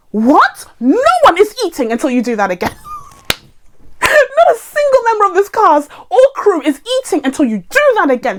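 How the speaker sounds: high, indignant director laying down the law; hammed-up fury at the whole crew; mock outrage. Director refusing the crew lunch after a bad take, mock outrage.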